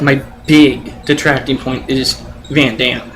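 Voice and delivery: ordinary talk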